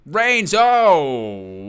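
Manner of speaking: loud, drawn-out cry of shock; shocked reaction. Real, not acted. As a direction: commentator jolted by a sudden big hit. Reacts to a sudden big boot.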